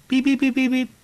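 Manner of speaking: rapid high, loud beeps; string of electronic-style beeps on one high pitch; imitated meter beeping. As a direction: answer as a beeping machine. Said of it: "she would beep yes".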